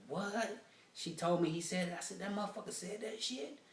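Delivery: high-pitched incredulous reaction; retold disbelief. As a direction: re-enact being stunned by gossip, voice lifted high. Retold shocked reaction to gossip.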